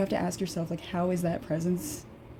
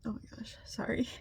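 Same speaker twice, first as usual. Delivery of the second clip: whisper; 4 semitones higher, 9 dB quieter than usual. Real, not acted. Overcome, breathes apology.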